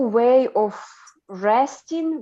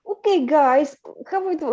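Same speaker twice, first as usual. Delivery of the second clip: lightly played-up Russian accent, higher; leaned-into Russian accent; 6 semitones higher than usual. Character greeting the guys in a playful Russian accent. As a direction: lean into a Russian accent a little. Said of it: "I would lean into my accent".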